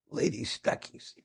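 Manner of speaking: slow whispered answer; hushed whispered reply. Retold or imitated. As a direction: breathe it out as a secret. Answering the driver's secret question.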